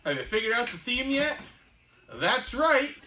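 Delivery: teasing reveal. Real, not acted. Asking viewers about the theme, excitedly teasing. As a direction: tease the audience before the answer.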